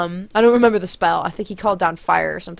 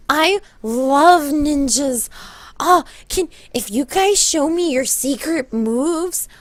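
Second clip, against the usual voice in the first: high, stretched-out gushing fangirl; excited girl impression; 6 semitones higher, slower than usual. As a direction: gush slowly in a high imitated girl's voice. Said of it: "very accurate representation".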